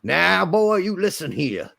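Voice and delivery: put-on a light old Georgian drawl on "Now, boy"; a hint of the old Confederate Georgian accent, half-joking; playful imitation of an old Georgian accent; old Southern accent. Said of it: "old Confederate Georgian accent".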